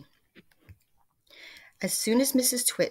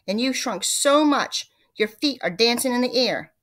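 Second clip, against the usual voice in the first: higher, louder, drawing out the alarm; crying out; 5 semitones higher, 7 dB louder than usual. A narrator's reading. Mister Twit telling his wife she has shrunk, crying out. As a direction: exclaim it loudly, leaning on each phrase. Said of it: "he cried".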